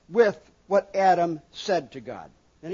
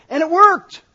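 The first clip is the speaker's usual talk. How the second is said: a burst much louder and higher; excited exclamation; 8 semitones higher, 12 dB louder than usual. Real, not acted. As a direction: shout it out, amazed. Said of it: "exclamation repeated".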